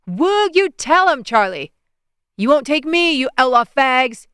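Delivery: put-on high, loud drunken jeering; drunk hollering. A narrator's reading. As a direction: holler it like a wasted jock. Drunk guys hollering at statue.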